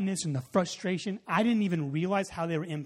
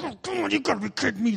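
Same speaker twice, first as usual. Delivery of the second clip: fast, higher exasperated groan of words; can't believe your bad luck; mock frustrated outburst; retold exasperation; 8 semitones higher, faster than usual.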